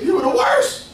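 high yelling mock tantrum; angry son. Older brother yells at his father, hammed-up. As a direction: shriek it like a sulking child. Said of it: "you know what he did".

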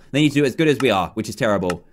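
ordinary delivery

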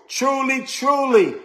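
TRULY, TRULY both high and firmly stressed; emphatic repetition. Shown as a demonstration. Preacher stressing the doubled word in the verse, emphatic. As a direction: lift each "truly" well up and land it. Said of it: "There's an emphasis on the word truly".

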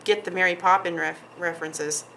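normal talking voice

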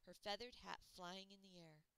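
usual speaking voice

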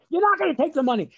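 fast, high, loud refusal; mother shouting back. Retold or imitated. Mother forbidding the money in a quarrel, screaming. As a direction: imitate a mother yelling over her husband.

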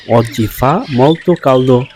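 very slow, each word laid out clearly; slow teacher demo; slow model reading. Shown as a demonstration. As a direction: spell the sentence out slowly for learners. Teacher models Italian sentence.

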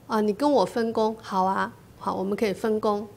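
ordinary talk, nothing marked

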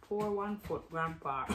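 hushed, sneaky jibe; muttered tease. Real, not acted. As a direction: repeat the tease under your breath. Same tease, muttered to herself.

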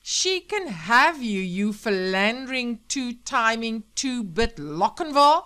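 loud, drawn-out string of insults; furious tirade. Acted. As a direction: pound out each insult at the cheating husband. Wife hurls insults at husband.

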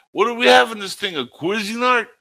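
high mock movie voice. Quoting Spaceballs characters.

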